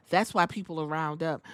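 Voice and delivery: ordinary talk, nothing marked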